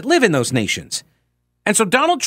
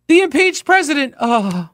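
mock outrage; 8 semitones higher than usual. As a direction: parody someone scandalized, pitched way up. Mocking those who crow about impeachment.

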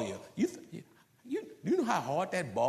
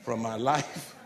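slow, low, soft and courteous; mock-polite request to go, said slow and soft; polite dismissal; 6 semitones lower, 5 dB quieter, slower than usual. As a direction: ask them gently but firmly to leave your life. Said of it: "it'd be better for you to just say".

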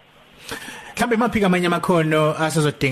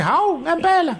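surprised; 13 semitones higher than usual. Retold or imitated. Surprised reaction in a retold chat.

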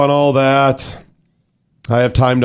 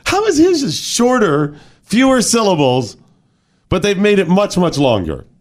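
a hint of a puzzled kid, higher; childhood bafflement; 8 semitones higher than usual. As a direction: ask it as a confused kid would. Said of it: "I was like".

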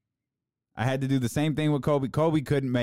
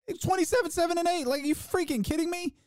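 high-pitched, incredulous outburst; worked-up anger. Real, not acted. Arguing a player's stats to doubters, angrily incredulous. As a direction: squeak with indignation, no extra volume. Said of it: "I love how I progressively got angrier".

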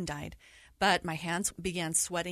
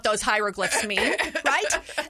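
worked-up inner rant; 6 semitones higher, 6 dB louder than usual. Retold or imitated. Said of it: "And I'm thinking".

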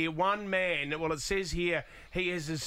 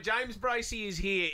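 ordinary talk

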